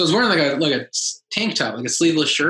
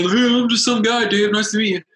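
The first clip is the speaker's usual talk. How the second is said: lightly put-on awkward fan greeting; imagined eager fan; 6 semitones higher than usual. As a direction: play an overeager nobody introducing himself. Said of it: "I didn't want to say".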